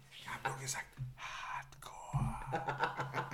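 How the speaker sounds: soft laughing whisper; whispered with a giggle. Real, not acted. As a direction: breathe it out unvoiced, giggling. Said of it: "Ich flüster gar nicht".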